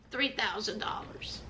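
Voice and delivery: soft conspiratorial whisper; whispered shade. Real, not acted. Throwing shade at Courtney's outfits, whispered. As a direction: drop to a whisper for the dig. Shady aside about her clothes.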